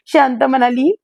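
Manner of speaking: usual speaking voice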